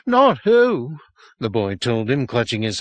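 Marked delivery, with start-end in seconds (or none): 0.1-1.0 higher, louder correction; insistent boy. A narrator's reading. Boy corrects the sergeant, higher and insistent. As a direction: correct him firmly.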